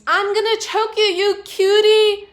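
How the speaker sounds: high, loud, gushing scream at a cute dog; screaming vet. Retold or imitated. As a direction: scream it like an overexcited tough lady. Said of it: "who screams".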